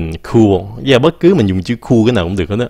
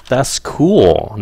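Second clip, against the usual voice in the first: casual slang; 4 semitones higher than usual. Shown as a demonstration. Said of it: "nghe nó trẻ trung hơn".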